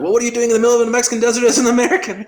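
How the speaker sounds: high, loud, fast retort; mock-astonished counter-question; incredulous comeback. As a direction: fire the question right back, rapid and high. The Australian throws the question back.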